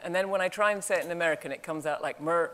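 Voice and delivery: ordinary talk, nothing marked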